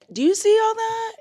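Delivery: a squeaky, thrilled question; excited squeal. Real, not acted. Showing viewers the huge pile of water, squealing.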